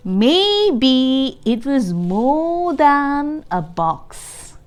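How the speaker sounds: put-on lively reading to young children; storybook read-aloud. A narrator's reading. Teacher reading Pete the Cat to the class, lively read-aloud. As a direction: read it like a picture book to kids.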